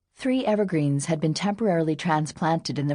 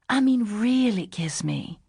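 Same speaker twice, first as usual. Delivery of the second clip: sexy growl; 5 semitones higher, slower than usual. A narrator's reading. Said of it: "she said and growled sexily".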